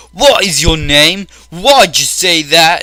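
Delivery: loud, slow mock outrage; hammed-up angry demand; mock confrontation. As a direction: imitate an indignant accuser, stretched and loud. Voices the angry feminist confronting him.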